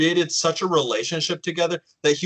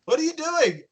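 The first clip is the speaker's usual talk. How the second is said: bewildered; 11 semitones higher than usual. Retold or imitated. Confused at ex at door.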